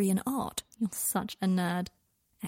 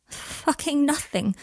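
light and trembling; 6 semitones higher, 3 dB louder, slower than usual. A narrator's reading. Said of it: "his voice light and trembling".

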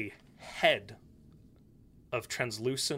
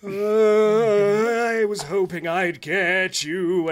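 gloating ghost; 6 semitones higher, 9 dB louder, much slower than usual. Acted.

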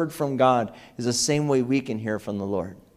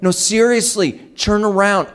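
higher-pitched, urgent retold plea; insistent warning; 6 semitones higher than usual. Father warning his daughter off the door, insistent. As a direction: press the warning in a raised pitch.

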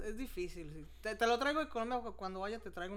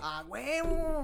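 emphatic exclamation; 5 semitones higher, much slower than usual. Real, not acted. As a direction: stretch it out with emphatic conviction. Afirma con entusiasmo ante la incredulidad. (exclamación enfática y alargada)

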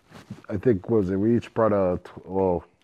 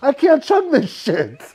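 strained complaint; 13 semitones higher, 4 dB louder, slower than usual. Retold or imitated. Retold struggle to chug.

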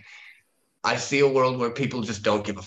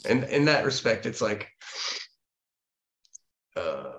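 trailing off. Real, not acted. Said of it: "I lost it".

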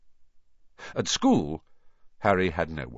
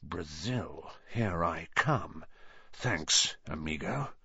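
low, much softer snake hiss; 5 semitones lower, 9 dB quieter, slower than usual. A narrator's reading. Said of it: "a low, hissing voice said".

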